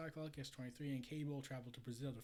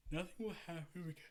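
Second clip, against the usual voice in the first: talking through a yawn, slightly raised pitch; 3 semitones higher, faster than usual. Real, not acted. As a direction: let a yawn swallow the words. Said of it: "I keep yawning for some reason".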